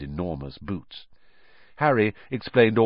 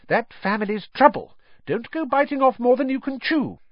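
stern mum; 4 dB louder than usual. Acted. Wife warning husband.

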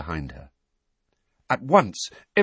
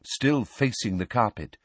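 ordinary delivery

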